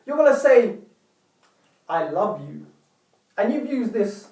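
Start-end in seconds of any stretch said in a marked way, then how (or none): 1.9-2.7 low, half-hearted declaration; non-hearted. Shown as a demonstration. Speaker shows viewers a non-hearted tone, low and indifferent. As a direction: say it without any heart. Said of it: "this non-hearted tone of voice".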